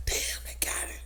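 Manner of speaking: very soft whispered dismay; hushed, retold inner thought; hiding whisper. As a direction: whisper to yourself so you aren't seen. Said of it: "I'm sitting there trying to hope, hope he don't see me".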